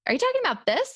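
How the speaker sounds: ordinary talk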